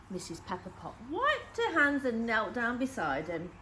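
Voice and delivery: ordinary delivery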